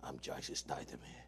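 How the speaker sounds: low, soft mock British gangster voice; Jason Statham impression. Doing impressions for his cohost, a low mock Statham. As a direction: drop way down into a quiet Cockney growl. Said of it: "I can do Jason Statham".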